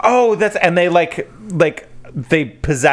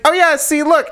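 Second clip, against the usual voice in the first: high bright mock eagerness; eager salesman; 3 dB louder than usual. Demon shows off the letters in a high eager voice. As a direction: push it up high like a pushy host. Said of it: "And he's like".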